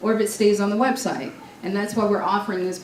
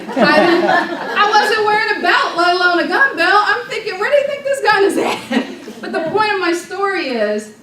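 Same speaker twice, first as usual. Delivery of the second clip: high, loud, laughing through the story; 9 semitones higher, 6 dB louder than usual. Real, not acted. Retelling how she scared the club, laughing loudly. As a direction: crack up as you tell it.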